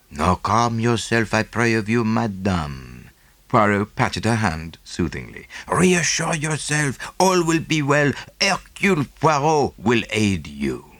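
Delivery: put-on slow, coaxing foreign-accented detective; calm her down, measured and precise; soothing Belgian detective. Poirot voiced by narrator.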